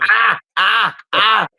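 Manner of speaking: imitating a kid's high pained yelps in a squeezed headlock; mock pained yelp. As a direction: yelp like a kid whose head is crushed. Kid in a painful headlock.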